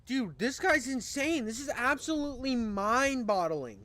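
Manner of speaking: can't believe what he's seeing; amazed outburst. Real, not acted. Amazed reaction to smoker.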